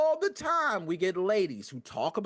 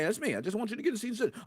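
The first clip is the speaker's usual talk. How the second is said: normal talking voice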